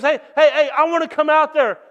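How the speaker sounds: calling out. Acted. Peter calls from the boat to Jesus, loud. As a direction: shout across the water to Jesus.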